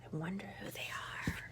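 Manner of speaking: hushed curious whisper. Real, not acted. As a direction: lean in and whisper. Narrator's hushed aside.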